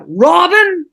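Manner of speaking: fed-up coach calling the student; exasperated call. Retold or imitated. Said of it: "And he's like".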